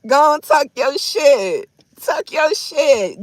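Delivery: loud, high, slow drawn-out cheer; hype cheering. Retold or imitated. Woman egging on a man's smooth talk, hype cheering. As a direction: egg him on, stretching every word high and loud.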